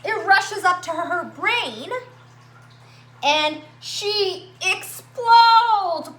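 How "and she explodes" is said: louder and drawn out, she explodes; explosive build. A narrator's reading. Reading to kids, the girl loses her temper, loud slow blow-up. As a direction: let the word blow up slowly, louder.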